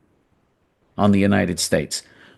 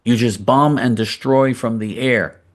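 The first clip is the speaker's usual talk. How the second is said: ordinary delivery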